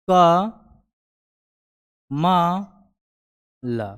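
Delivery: क, म, ल said slowly as separate syllables with long pauses; sounding out syllables. Shown as a demonstration. Teacher blending syllables for the class, slow and separate. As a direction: spell it out syllable by syllable. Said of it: "जब तीनों को आपस में जोड़ेंगे".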